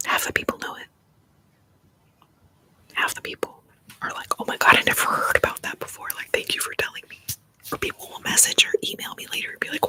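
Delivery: hushed, fully whispered livestream chat; ASMR whisper. Real, not acted. ASMR host whispers about scams to viewers, whispered. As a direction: whisper confidentially to the listeners.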